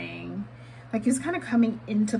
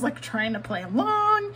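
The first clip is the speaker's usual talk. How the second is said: ordinary talk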